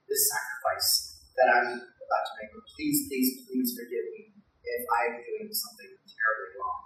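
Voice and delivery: very soft, pleading prayer; hushed acted plea to God; desperate prayer. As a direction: beg forgiveness in a tiny hushed voice. Desperate prayer before shooting lamb.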